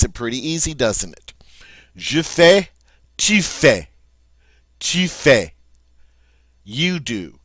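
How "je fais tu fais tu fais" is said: "Je fais, tu fais" said loud and high, both FAY; French drill. Shown as a demonstration. Teacher drilling the class on tu fais, loud and high. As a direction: drill "tu fais" rhyming with "je fais", louder. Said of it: "It is identical to the je form".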